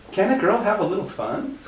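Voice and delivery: high, lilting girlish imitation; mock cheeky girl. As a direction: answer the scolding with a playful falsetto retort. Other girl's cheeky retort imitated.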